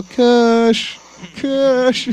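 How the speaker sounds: calling out for the cat; loud yell across the yard. Retold or imitated.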